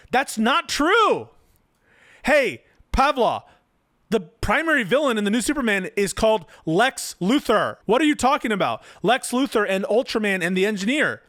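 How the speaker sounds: loud, high-pitched exasperated objection; real exasperation, pitched well up; indignant outburst. Real, not acted. Outraged contradiction of the article's claim.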